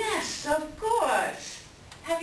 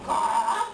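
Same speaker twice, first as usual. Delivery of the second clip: a harsh louder caw; 5 dB louder than usual. Acted. Raven caws, eager to leave now. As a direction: caw impatiently.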